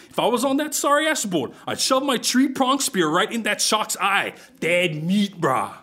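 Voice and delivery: loud, pumped-up local boast; swaggering brag. Acted. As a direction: brag big like a tough fisherman. Oz bragging about spearing shark.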